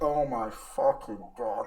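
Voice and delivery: slow, drawn-out disbelief; mock cringing dismay. YouTuber watching his old videos back, mock appalled. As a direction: groan it slowly in mock horror.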